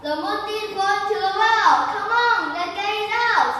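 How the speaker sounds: high, loud excited shout; rally the others, shouting; group shout. Acted. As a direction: monkeys shouting to pull out the moon. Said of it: "and shouts".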